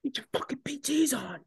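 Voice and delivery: strained, high mock yell; mock corporal's high-pitched command; imitated angry corporal. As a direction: order him about in a hammed-up screech. Corporal orders drunk marine to change.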